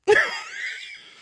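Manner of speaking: loud high burst of laughter; real laughing shout. Real, not acted. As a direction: let out a big laugh. Laughs at own joke.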